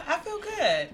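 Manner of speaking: laughing through the words; a nervous laugh under it. Real, not acted. Nervous guest answering how she feels.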